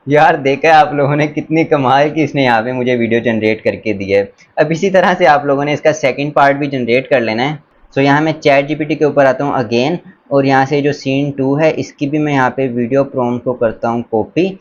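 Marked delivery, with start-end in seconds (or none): none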